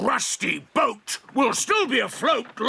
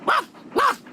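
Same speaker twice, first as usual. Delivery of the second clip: dog bark; 13 semitones higher than usual. Acted. Dog barks.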